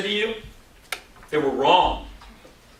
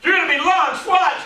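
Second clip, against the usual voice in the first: very high-pitched, keyed-up warning; acting out the teacher just before the shot; excited teacher; 12 semitones higher, 4 dB louder than usual. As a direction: launch it high and urgent. Teacher about to fire rubber band.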